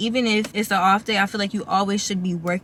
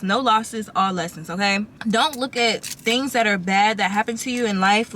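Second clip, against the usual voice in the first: ordinary delivery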